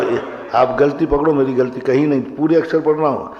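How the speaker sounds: ordinary talk, nothing marked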